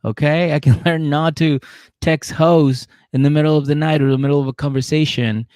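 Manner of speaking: high, loud hammed-up plea; mock pleading boyfriend. Derek pleading with Monique at the rink, hammed-up. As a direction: beg her back, proud and pleading. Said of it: "he's like".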